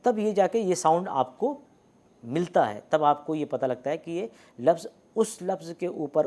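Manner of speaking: normal talking voice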